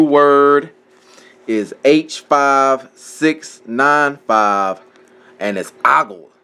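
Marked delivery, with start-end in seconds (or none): 5.8-6.3 "ogle" said AH-gul, stress first; pronunciation demo. Shown as a demonstration. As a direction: say "ogle" as AH-gul, falling at the end. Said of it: "I believe that's how you say it".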